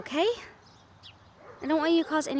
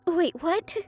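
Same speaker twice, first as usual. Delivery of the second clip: usual speaking voice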